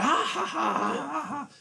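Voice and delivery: high mocking laugh. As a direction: laugh at him like the mocking Americans. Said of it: "They'd be like".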